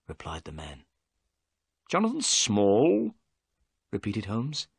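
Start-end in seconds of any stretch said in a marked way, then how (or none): 1.9-3.1 higher, slower, rising on the name; surprised repeat. Acted. Holmes echoing the prisoner's name, surprised rising question. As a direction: echo the name back in surprise.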